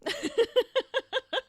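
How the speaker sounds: high laugh; bright giggle. Real, not acted. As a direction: laugh at own silliness. Laughing at forgetting item.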